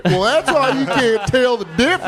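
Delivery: high, hammed-up Southern mockery; Southern accent. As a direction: crow it in a Southern drawl. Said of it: "Southern accent".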